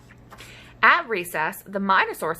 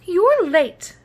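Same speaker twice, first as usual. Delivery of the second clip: stern teacher; 10 semitones higher, 5 dB louder than usual. Acted. Teacher scolds late pupil.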